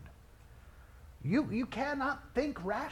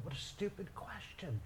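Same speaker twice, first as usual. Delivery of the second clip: ordinary talk, nothing marked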